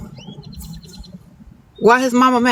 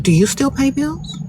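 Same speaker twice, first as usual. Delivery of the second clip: fake innocent question; 6 semitones higher than usual. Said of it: "I'm like".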